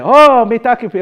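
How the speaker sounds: high, loud mock greeting; mock exclamation. Acted call to his class.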